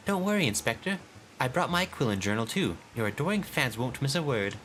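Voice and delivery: high, bright eager helper voice; cheerful young assistant. Acted. Assistant Nashu reassuring the inspector, high and cheerful. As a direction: chirp it out with boundless joy.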